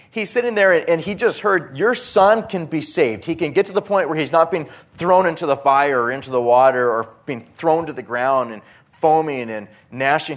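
rising preacher's urgency; fervent preaching. Acted. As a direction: press the point home with fervour. Preaching at full force.

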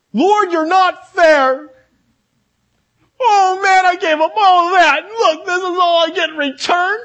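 high, loud crying protest to God; whining complaint. Acted. A believer sobbing loudly to the Lord at judgment, crying shout. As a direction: wail it like a cheated believer.

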